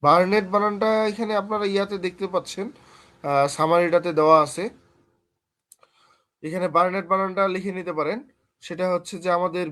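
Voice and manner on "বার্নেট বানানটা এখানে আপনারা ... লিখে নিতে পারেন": usual speaking voice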